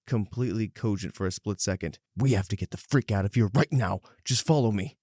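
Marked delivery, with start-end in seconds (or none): 2.2-5.0 urgent hiss. Acted. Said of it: "Then she hisses".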